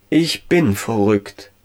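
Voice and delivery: slow, drawn-out self-diagnosis; convinced murmur. A narrator's reading. Ralf diagnoses himself after seeing the angel, convinced murmur. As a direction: say it slowly to yourself, utterly convinced. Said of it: "murmelte er".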